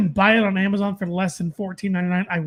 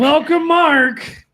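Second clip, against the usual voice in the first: slow grand welcome; 6 semitones higher, 6 dB louder, much slower than usual. Pretend. Drawn-out mock announcer welcome.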